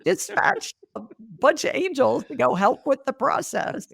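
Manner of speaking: hammed-up heavenly boss giving orders; God as dispatcher. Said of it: "he'd say".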